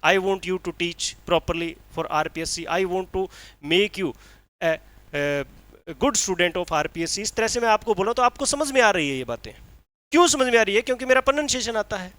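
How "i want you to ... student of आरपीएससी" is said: slow, carefully spaced English; slow clear English. Shown as a demonstration. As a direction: speak each word slowly so the class follows. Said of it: "मैं बोल रहा हूँ". (धीमी, साफ़ अंग्रेज़ी में बोलिए)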